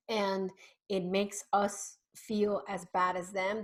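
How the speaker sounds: ordinary talk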